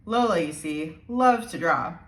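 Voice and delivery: ordinary delivery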